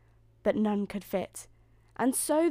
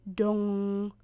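clock chime. A narrator's reading. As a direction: ring out like a striking clock. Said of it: "run the clock".

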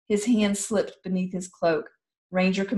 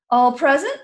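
loud, higher roll call; 8 semitones higher, 8 dB louder than usual. Acted. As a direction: call across the hall. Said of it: "called Ranger Commander".

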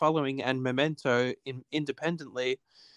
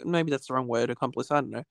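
ordinary talk

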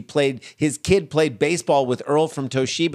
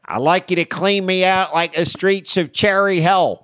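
put-on Philly accent; 4 semitones higher than usual. Host riffing on Philly Zoom porn, in a hammed-up Philly accent. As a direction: lay on a thick Philly accent.

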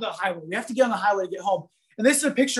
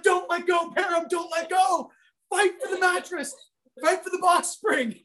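high, pleading shouts; desperate yelling; 7 semitones higher than usual. Retold or imitated. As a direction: urge him on at the top of your voice. Driver urging friend to hold.

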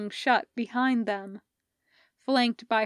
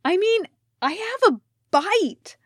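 pained yell; 10 semitones higher, 7 dB louder than usual. Acted. Hardison bitten by a crab.

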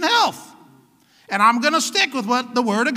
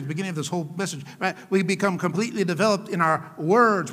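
normal talking voice

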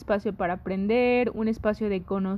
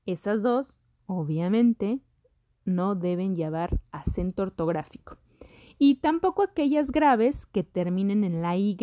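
ordinary talk, nothing marked

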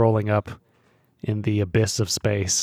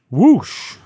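high loud whoosh; whoosh sound effect; 9 semitones higher, 7 dB louder than usual. Acted. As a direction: make the ship whoosh by.

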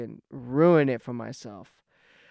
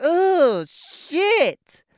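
much higher and louder, disgusted groan; grossed-out outburst; 13 semitones higher, 7 dB louder than usual. Retold or imitated. Throwing the jeans away, he shouts in disgust. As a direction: recoil at the smell again. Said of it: "I was like".

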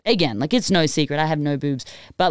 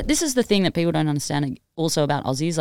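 ordinary delivery

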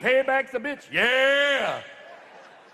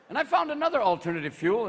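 normal talking voice

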